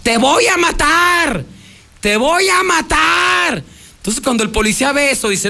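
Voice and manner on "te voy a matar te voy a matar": high, drawn-out mock yell; slow yelled threat, much higher; imitated threatening shout. Reporter quoting the woman threatening the policeman, mock shout. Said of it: "le comienza a gritar".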